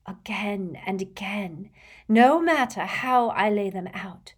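slow, building from hushed to loud; dramatic seer, insistent. Acted. Trelawney warning Harry about the cards, dramatic. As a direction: draw it out with mounting drama.

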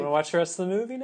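teasing mom. Retold or imitated. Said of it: "she's like".

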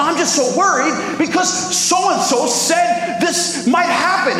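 high, drawn-out anxious wail; mock panicky worry. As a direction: imitate a worrier spiralling into panic. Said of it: "shortness of breath and the heart palpitations".

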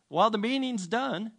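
high-pitched mock announcement; disembodied announcer. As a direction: call it out as a voice from somewhere. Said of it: "like this voice comes from somewhere".

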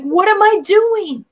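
high, startled self-questioning; retold jolt of alarm at oneself; suddenly shocked at yourself. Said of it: "I was startled".

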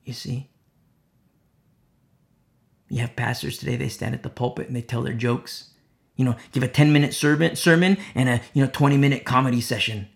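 ordinary talk, nothing marked

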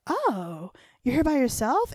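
high, loud surprised question. Retold or imitated. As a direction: act amazed she came alone. Actors surprised she came alone.

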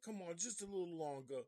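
put-on a kid begging his mother, in a light northern accent. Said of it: "that's my northern voice".

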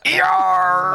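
booming pirate roar; pirate yell. Acted. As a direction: bellow it like a pirate captain. GM voices pirate raising portcullis.

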